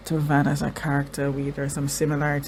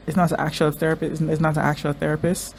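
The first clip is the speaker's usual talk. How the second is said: ordinary delivery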